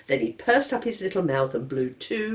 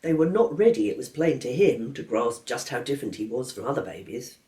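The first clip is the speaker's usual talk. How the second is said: plain reading voice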